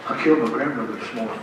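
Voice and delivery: very soft, low and quick; imitated whisper. Roosevelt whispering to a prime minister, hushed. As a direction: murmur the confession confidentially, barely audible. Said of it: "he whispers".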